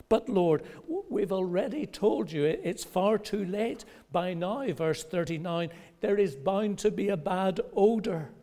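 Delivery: high, loud, hurried objection; agitated protest. Retold or imitated. Martha objecting to opening her brother's tomb, high and loud. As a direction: protest urgently.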